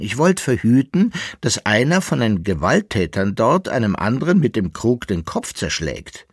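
animated character voice with big pitch swings; lively self-justifying. Acted. Koppelbär defends himself to Jäckele-Narr, animated and self-righteous. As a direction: protest innocence with expansive emphasis. Koppelbär protests his innocence.

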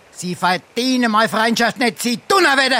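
building from stiff to a loud huffy yell; indignant outburst. Acted. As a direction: throw it at him, furious at the insult. Quarrel, breaking off friendship.

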